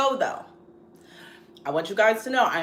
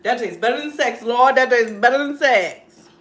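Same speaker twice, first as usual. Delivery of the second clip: loud delighted food praise; excited; 3 semitones higher, 9 dB louder than usual. Real, not acted. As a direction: rave about the taste. Tasting food, exclaims.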